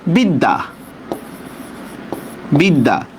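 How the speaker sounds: বিদ্যা said "bid-da", the d doubled; doubled consonant. Shown as a demonstration. Teacher showing the doubling rule, doubled-d pronunciation. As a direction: say বিদ্যা twice, each with two d sounds. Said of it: "সেটা দুইবার উচ্চারণ হবে".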